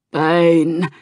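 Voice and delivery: put-on a touch of a gruff old orc. A narrator's reading. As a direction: call his name, gruff and weathered. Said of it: "said a gruff voice".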